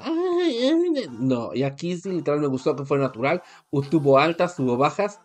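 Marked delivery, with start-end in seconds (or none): 0.0-1.5 high-pitched "ay" in imitation; put-on high motherly wail; mock mother hen. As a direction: coo it high, like a fussing mama. Mimics doting mother hen contestant.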